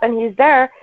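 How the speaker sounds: normal talking voice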